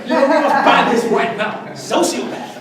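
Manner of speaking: loud, laughing, hammed-up scandalised voice; mock outrage. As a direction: play the horrified censor, laughing. Mocking moral panic over rap.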